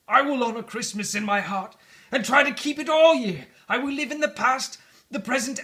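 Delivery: high, loud, urgent begging; desperate pleading. Acted. Scrooge begging the spirit to change his fate, desperate. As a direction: plead for your life, voice straining.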